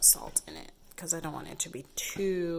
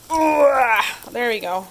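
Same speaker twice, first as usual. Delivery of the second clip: excited; 4 semitones higher, 13 dB louder, slower than usual. Real, not acted. Garlic press finally works.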